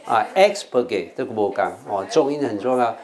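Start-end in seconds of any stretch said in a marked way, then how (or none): 0.3-1.1 "expurgate" with EX stressed, rest light; stress at the front. Shown as a demonstration. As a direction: lead with a strong EX in "expurgate". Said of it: "那是重音在前面". (expurgate 重音在 ex，后面轻读)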